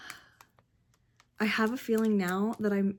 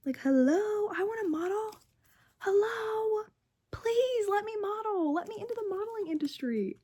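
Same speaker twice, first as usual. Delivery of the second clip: much higher whiny plea; mock pleading; 11 semitones higher than usual. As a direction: beg jokingly to be let in.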